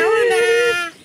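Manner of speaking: ordinary talk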